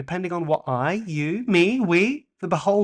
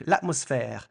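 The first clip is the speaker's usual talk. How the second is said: ordinary delivery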